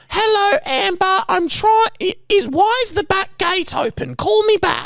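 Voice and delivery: put-on very high, quavering little-old-lady voicemail; old woman voice. Imitating the old woman's voicemail, very high. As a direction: squeak it out like a confused grandma. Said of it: "left a voice message like".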